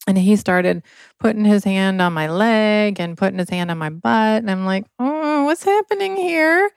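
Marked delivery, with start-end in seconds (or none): 5.0-6.7 confused younger self. Retold or imitated. Teen girl confused by priest.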